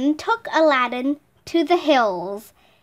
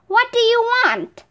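higher-pitched genie's question, lightly voiced; a touch of imperious genie, pitched up; 8 semitones higher than usual. A narrator's reading. Genie of the ring asks Aladdin, lightly imperious. Second genie addresses his summoner.

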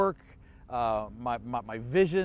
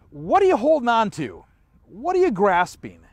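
louder, pressing question to the congregation; emphatic question; 4 semitones higher, 8 dB louder than usual. Real, not acted. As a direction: lean into the question, loud and urgent. Preacher's pointed question to listeners.

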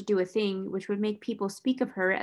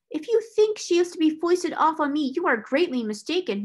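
high, loud indignation; gasped outrage; 7 semitones higher, 4 dB louder than usual. A narrator's reading. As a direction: protest in high-pitched outrage. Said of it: "Miss Minchin gasped".

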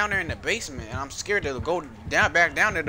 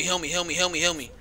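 ordinary delivery

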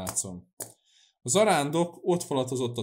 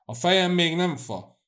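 raised, irritated grumble to himself; angry grumbling; 7 semitones higher, 6 dB louder than usual. A narrator's reading. Cecei stamps about the yard alone, angry grumbling. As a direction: grumble crossly, higher and louder. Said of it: "és haragosan mormogta".

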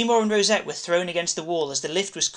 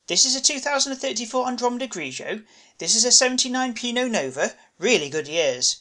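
Seymour's voice; 7 semitones higher than usual. A narrator's reading.

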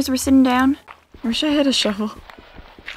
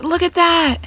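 normal talking voice

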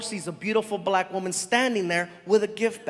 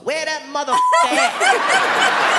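high, loud, slow laughing eagerness; suddenly all in; eager laughing turnaround; 6 dB louder, slower than usual. Retold or imitated. Friend agreeing for fifty bucks, high laughing eagerness.

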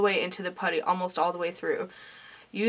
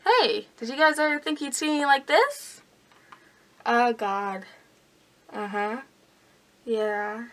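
cheek retractor in mouth; speaking with lips stretched open; lips held apart; 3 semitones higher than usual. Real, not acted. Seen 0: Clear plastic retractor holds lips wide apart through all labelled frames.

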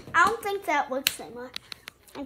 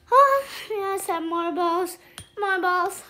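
high excited discovery; thrilled to find more balls; 5 semitones higher, 5 dB louder than usual. Real, not acted.

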